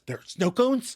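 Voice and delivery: high, eager disbelief; light up at the thought of snow cones; excited. Retold or imitated. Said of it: "Chandler excitedly pants".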